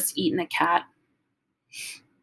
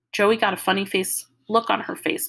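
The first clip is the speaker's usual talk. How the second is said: usual speaking voice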